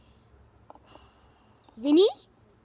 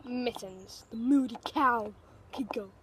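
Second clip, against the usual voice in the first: normal talking voice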